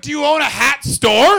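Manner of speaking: high, loud deadpan comeback; loud mock question. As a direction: shout the retort straight at him. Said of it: "went outside and went".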